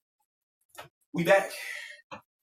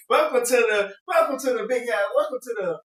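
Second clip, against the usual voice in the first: high, loud mock welcome voice; high mock greeter voice; 9 semitones higher, 7 dB louder than usual. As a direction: put on a high, hammy greeter voice.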